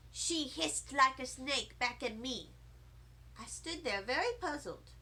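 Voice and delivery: plain reading voice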